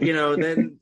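normal talking voice

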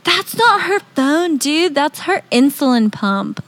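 a hint of a laid-back surfer; lightly voiced surfer dude. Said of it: "someone who knew me was like".

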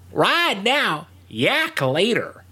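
a lightly voiced biker mom, high and slow; biker lady voice. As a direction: hand it off like a tough old biker mom. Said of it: "she also says".

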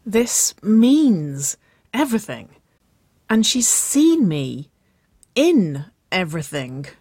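high, slow, emphatic complaint; frustrated wail. A narrator's reading. Woman explaining her outfit worry to sister, exasperated. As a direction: stretch each key word in exasperation.

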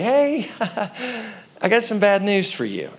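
mock cheery. Mock cheerful call to wife.

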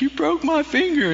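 imitating a mom crying over a broken finger. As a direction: whimper it up high like a crying mother. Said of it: "My mom was crying, and she's like".

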